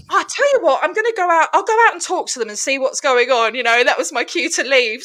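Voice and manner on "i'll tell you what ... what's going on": bright idea pitched high at the start; feigned helpfulness. Retold or imitated. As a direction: offer it eagerly as an excuse to leave. Feigned helpfulness to get away.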